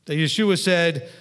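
ordinary talk

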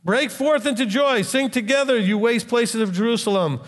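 high, ringing proclamation; preaching scripture. Acted. Preacher calling the verse out to his listeners, jubilant and ringing. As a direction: call it out like a herald of joy.

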